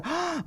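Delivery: high sharp gasp of delight; mock gasp. Mom meeting her daughter's date, hammed-up gasp. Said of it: "She goes".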